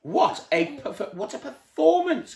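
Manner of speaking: high, drawn-out mocking remark; scornful comment. A narrator's reading. The worm mocking Mister Jelly's panic, high scornful remark. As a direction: sneer at the fuss. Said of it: "commented the worm".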